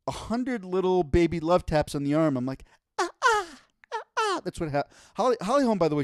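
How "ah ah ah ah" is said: high, sharp grunting cries; tennis grunt impression. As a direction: mimic a tennis player's grunt on each hit. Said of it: "she sounds like".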